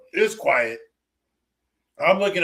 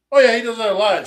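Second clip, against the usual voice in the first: breezy reply; 7 semitones higher, 8 dB louder than usual. Retold or imitated. Retelling a dismissive reply to her alarm.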